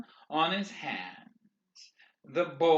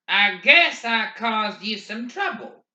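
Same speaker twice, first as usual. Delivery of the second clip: ordinary delivery, nothing marked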